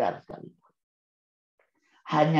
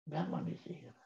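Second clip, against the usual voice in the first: much softer, almost to himself; soft echo; 15 dB quieter than usual. Shown as a demonstration. Speaker to parents, echoing his point much softer. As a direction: let the phrase fade as a quiet echo. Repeats the point, then echoes it.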